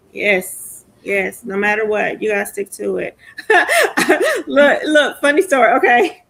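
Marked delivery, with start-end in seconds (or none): 3.2-4.4 loud high laugh bursting out; burst of laughter. Real, not acted. Laughing before a funny story. 4.5-6.2 talking through laughter, much louder; laughing. Real, not acted. Laughing while starting the story.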